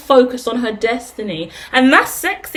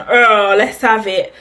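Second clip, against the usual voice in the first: slow, gruff-sounding macho lad; butch bloke voice; 3 semitones lower, slower than usual. Retold or imitated. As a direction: drawl it like a big butch bloke. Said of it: "the masculine's big and butch".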